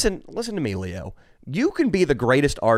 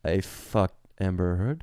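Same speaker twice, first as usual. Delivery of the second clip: Elon Musk impression; 8 semitones lower, 7 dB quieter than usual. Imitating Elon Musk boasting.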